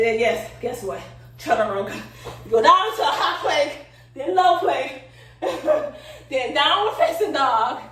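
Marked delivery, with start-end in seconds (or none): 2.4-7.8 laughing. Real, not acted. Laughing through yoga flow.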